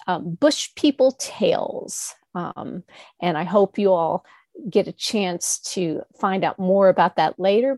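ordinary delivery, nothing marked

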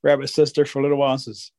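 plain reading voice

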